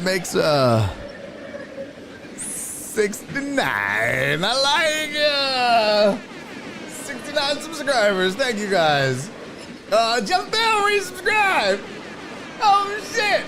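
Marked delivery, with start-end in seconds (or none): 2.4-6.2 very slow, stretched-out words swooping up high; playful savouring. Pretend. Streamer relishing the subscriber count, playful savouring. 9.9-13.4 high, hollered surprise; excited announcing. Real, not acted. Streamer announcing another resubscriber, excited surprise.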